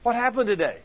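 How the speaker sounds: high, eager prying; pushy questioning. Acted. Wife greeting tired husband with questions, high pushy prying.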